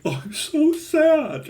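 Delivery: high, slow, whiny mockery of a sad heroine; mock sad character. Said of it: "she's like".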